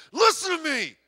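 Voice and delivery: high, loud pushy command; mock forceful demand. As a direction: grab them and insist, hammed up. Mock grabbing someone at door.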